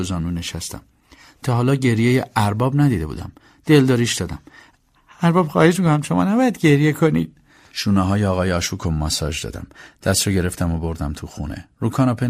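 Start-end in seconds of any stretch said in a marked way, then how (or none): 5.2-7.4 high-pitched, earnest plea; pleading. Acted. Servant comforting his weeping master, pleading. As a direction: beg him, pitched well above normal.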